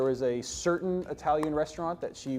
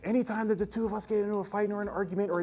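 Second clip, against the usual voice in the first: high, whiny, accusing rant; upset ex-girlfriend; 10 semitones higher, faster than usual. Retold or imitated. Ex-girlfriend accusing him of taking Sally's side, high whiny complaint. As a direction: pitch it up and complain that she's always second. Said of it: "she starts saying things like".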